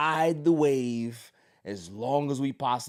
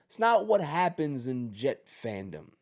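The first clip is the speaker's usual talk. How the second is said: ordinary talk, nothing marked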